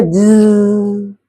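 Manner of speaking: "ज" drawn out as a long zzz; buzzing Z sound. Shown as a demonstration. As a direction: hold "ज" in a steady buzz. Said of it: "आवाज निकलती ज करके". ("ज" लंबा भिनभिनाता ज़्ज़्ज़)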